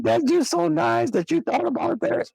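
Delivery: high, moved woman's voice, imitating; girlfriend's voice. Cousin's girlfriend voiced, crying.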